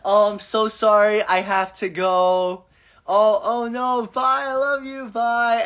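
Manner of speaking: sweet sing-song goodbye. Retold or imitated. Herself saying goodbye at the end of a call, high and slow. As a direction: stretch it out sweetly like ending a call with a loved one. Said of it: "I'm like".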